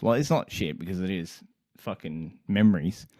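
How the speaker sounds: usual speaking voice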